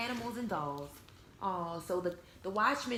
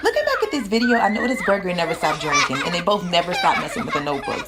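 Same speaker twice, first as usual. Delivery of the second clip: loud laughter through the words, fast; real laughing; 4 semitones lower, 15 dB louder, faster than usual. Real, not acted. Teacher looking back at the video, laughing loudly, fast.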